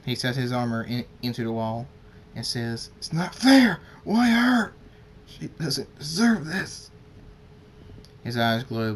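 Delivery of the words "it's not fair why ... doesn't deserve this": much higher, louder furious cry, slowing at the end; anguished shout. Acted. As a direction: rage at the unfairness, full voice. Father raging at daughter's fate.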